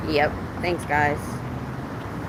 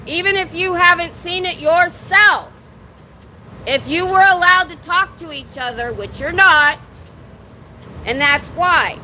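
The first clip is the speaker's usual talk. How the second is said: drill sergeant voice. Real, not acted. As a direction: shout it out to the building. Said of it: "I'm using my drill sergeant voice".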